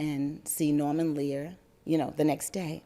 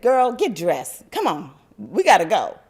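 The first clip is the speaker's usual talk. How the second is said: stern mother; 3 semitones higher, 8 dB louder than usual. Retold or imitated. Mother ordering her daughter to get dressed, loud and firm. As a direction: lay down the law, mom style.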